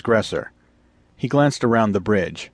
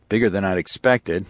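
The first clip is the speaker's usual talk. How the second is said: ordinary delivery, nothing marked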